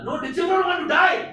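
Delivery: high laughing shout of a zealot; laughing mock reply. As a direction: shout it through laughter, hammed-up. Acted quote with laugh.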